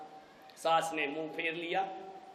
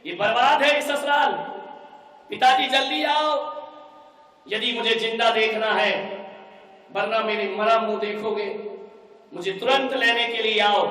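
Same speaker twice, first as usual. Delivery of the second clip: furious threat; 3 semitones higher, 6 dB louder than usual. Acted. Daughter threatens her father.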